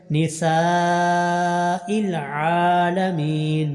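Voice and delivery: slow drawn-out tajweed chant; slow tarteel recitation; Quran recitation. Shown as a demonstration. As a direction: recite each vowel long and measured. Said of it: "سین کو لمبا کرنا ہے".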